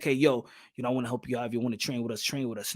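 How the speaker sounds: soft, low, offhand mock invite; casual invite. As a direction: toss it off quietly like a buddy. Mocking Eddie's casual invite.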